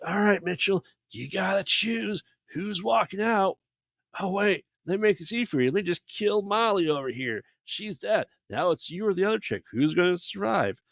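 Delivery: mock a villain laying out a cruel choice, high-voiced; high-pitched villain voice. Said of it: "he's a very high-pitched voice".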